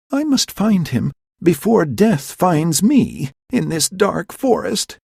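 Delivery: high, fearful resolve; scared but determined; frightened. Acted. Hermia alone in the dark forest, frightened.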